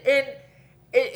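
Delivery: ordinary talk, nothing marked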